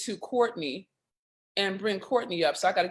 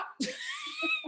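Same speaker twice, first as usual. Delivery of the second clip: very high, soft squeal of laughter; laughing; 6 dB quieter than usual. Real, not acted. Friends admitting they may cry, laughing. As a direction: laugh off the tears.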